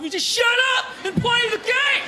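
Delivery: mock furious yell. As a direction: rage at the player, half laughing. Mimicking angry fan at TV.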